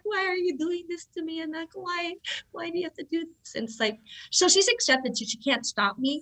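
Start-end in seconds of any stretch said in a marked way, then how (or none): 0.0-3.5 high, soft imitated lament; mock plaintive pleading. Distressed mom asking her injured daughter why, plaintive.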